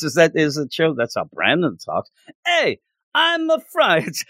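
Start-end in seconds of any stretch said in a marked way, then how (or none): 2.5-4.2 high, slow mock French Canadian accent. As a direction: drag it out like a cartoon French Canadian. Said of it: "suddenly it's French Canadian".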